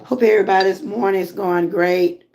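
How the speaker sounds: ordinary talk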